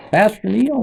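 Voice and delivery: high, loud calling out the pastor's name; old man calling. Retold or imitated. Elderly congregant calling the pastor after service, high loud call. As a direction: call down the aisle to get his attention.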